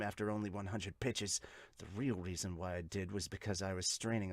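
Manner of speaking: low, soft inner-monologue voice; brooding pitcher. Acted. Pitcher resolving to stay calm, low brooding voice. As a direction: keep it low, quiet and self-critical.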